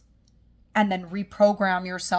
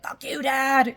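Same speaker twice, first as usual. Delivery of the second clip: a sudden raised outburst, higher and louder, at Dad; demo of rage; 6 semitones higher, 4 dB louder, slower than usual. Shown as a demonstration. Coach showing a client how to rage at her father, a raised outburst. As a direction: let the anger at Dad burst out in one line. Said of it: "Allow yourself to rage".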